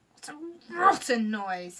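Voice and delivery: slow, loud, disgusted creaker; disgusted creature. Acted. Sniff the creaker complaining about Lucy's wailing, slow and loud. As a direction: drag it out, revolted. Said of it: "said Sniff".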